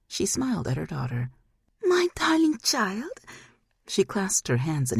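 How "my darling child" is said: high, slow, otherworldly coo; high slow demon-mother delight; demon mother's voice. Acted. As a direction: gush over the daughter as a demon. Demon mother greets daughter.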